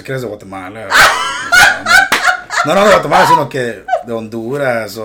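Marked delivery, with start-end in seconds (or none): 0.9-2.6 loud, very high burst of laughter; big high laugh. Real, not acted. Laughs at own joke. 2.7-5.0 loud teasing through laughter; laughing tease. Real, not acted.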